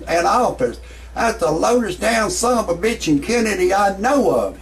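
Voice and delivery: normal talking voice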